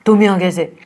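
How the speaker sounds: angry. Shown as a demonstration. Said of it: "गुस्सा हो गए".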